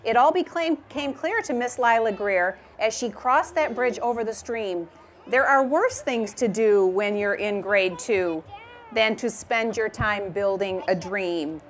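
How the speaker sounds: reading a rhyming picture book aloud; gentle storytime reading voice; story narration. A narrator's reading. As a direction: read it like storytime for a class.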